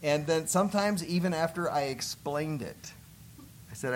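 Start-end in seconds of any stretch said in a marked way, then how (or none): none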